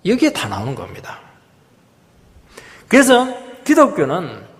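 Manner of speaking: ordinary talk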